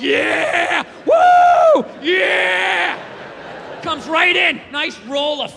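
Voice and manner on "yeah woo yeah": long whooping cheer, leaping high; ecstatic cheering. Acted. Body's reaction to sugary food, ecstatic cheering. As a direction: whoop it out, stretching each cry. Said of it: "makes my sugar salt go like".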